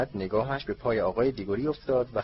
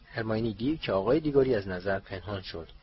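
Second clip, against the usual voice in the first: usual speaking voice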